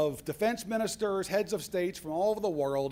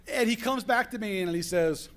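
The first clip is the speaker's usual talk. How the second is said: usual speaking voice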